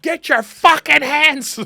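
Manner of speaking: high, loud, drawn-out scolding; screaming angry mom. Retold or imitated. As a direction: imitate a mother screaming in outrage. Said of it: "I can hear her voice in my head screaming".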